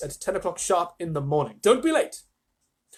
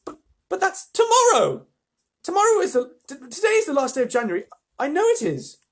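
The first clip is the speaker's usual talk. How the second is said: much higher, louder, stammering panic; flustered cry; 13 semitones higher, 6 dB louder than usual. Acted. Mrs Bucket realizing it's tomorrow, high flustered stammer. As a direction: tumble over the words in alarm. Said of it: "cried Missus Bucket".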